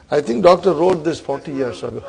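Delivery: ordinary talk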